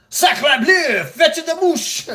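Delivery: loud, high hammed-up French accent; outrageous French accent. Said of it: "such an outrageous accent".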